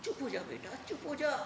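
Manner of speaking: soft, urgent plea for silence; hushed motherly pleading; frightened hushing. Acted. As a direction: hush him, voice dropping in volume. Frightened mother hushes her son.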